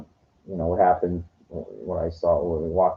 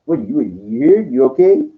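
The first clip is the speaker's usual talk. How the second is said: hostile deli guy; 6 semitones higher, 5 dB louder than usual. Retold or imitated. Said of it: "and he goes".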